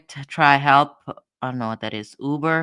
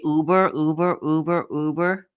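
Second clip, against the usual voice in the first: ordinary talk, nothing marked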